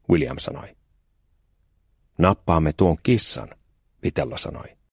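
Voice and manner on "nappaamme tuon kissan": slow sly plan. Acted. Boy announces his sly plan.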